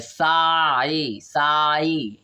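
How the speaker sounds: "साई" with the long i glide held out; drawn-out vowel sound. Shown as a demonstration. Teacher shows pupils the i sound, "साई" stretched slowly. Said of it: "आई आ रहा है कि नहीं".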